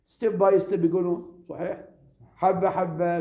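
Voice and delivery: normal talking voice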